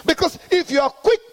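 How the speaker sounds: high, ringing call-and-response preaching; full-force preaching. Acted. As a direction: drive the congregation to answer back. Preaching to congregation.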